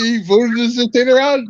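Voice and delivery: ordinary talk, nothing marked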